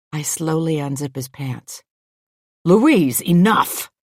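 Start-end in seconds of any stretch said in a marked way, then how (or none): 2.6-3.9 loud, sharp snap; snapped rebuke. A narrator's reading. Man fending off Louise's advances, snapping. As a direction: snap it, higher and louder, to stop them. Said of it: "he snaps".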